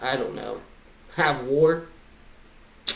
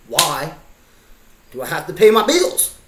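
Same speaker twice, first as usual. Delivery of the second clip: exasperated outburst; 2 semitones higher, 8 dB louder than usual. Acted. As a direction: rise into an exasperated yell. Exasperated rant of why questions.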